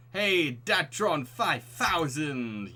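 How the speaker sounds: slow, drawn-out eager greeting; excited call. Acted. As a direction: call out the name with delight. Amanda calls out excitedly.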